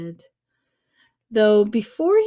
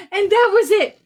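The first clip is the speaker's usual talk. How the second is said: laughing; 7 semitones higher, 4 dB louder, faster than usual. Real, not acted. Rereading the climax, amused.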